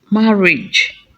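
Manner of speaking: usual speaking voice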